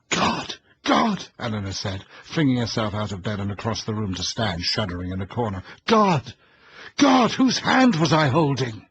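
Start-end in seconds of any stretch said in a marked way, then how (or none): 0.1-1.3 high, loud cry of terror; horrified cry. A narrator's reading. Eleanor in horror. 5.8-8.9 high, loud cry of terror; horrified cry. A narrator's reading. Eleanor realizes horror.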